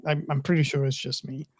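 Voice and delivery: ordinary talk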